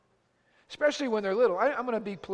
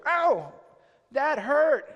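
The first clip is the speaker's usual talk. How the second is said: higher, louder mock yelp; spanked child protesting; 5 semitones higher, 7 dB louder, much slower than usual. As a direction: cry out in mock pain like a child.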